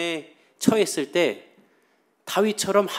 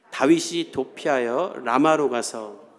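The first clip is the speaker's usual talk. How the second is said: scripture reading; slower than usual. Shown as a demonstration. Reads scripture aloud.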